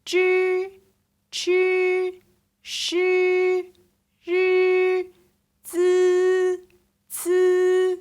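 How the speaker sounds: each syllable crisp, loud and separate; model syllable recital. Shown as a demonstration. Teacher reciting the whole syllables back for students. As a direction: recite the list as a clear model.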